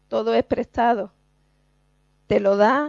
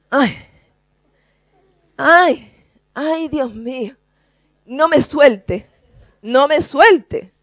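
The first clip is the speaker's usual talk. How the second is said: louder, slow, high pleading cry; mock desperate plea; 4 semitones higher, 7 dB louder, slower than usual. Preacher acting a drowning man clinging to Christ. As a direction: beg not to be let go.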